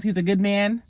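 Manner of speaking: lightly voiced disbelief, rising at the end; a mild mocking question; mock incredulous echo. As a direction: throw "He's a good man?" back at her in a half-joking, rising way. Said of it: "And I'm like".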